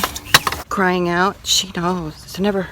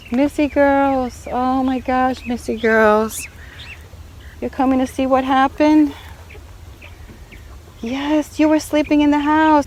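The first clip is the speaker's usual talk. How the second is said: higher, slow cooing voice; cooing to pet; 6 semitones higher than usual. Real, not acted. Greeting her dog Missy in a higher coo.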